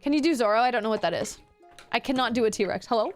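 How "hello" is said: ordinary delivery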